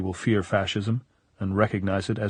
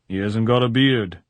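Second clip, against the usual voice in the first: louder, mildly smug delivery; self-satisfied; 3 semitones higher, 7 dB louder than usual. A narrator's reading. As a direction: sound pleased with your own point. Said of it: "very pleased with himself".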